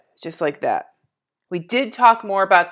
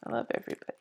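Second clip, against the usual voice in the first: whispered; 12 dB quieter, much faster than usual. Real, not acted. Affectionate aside.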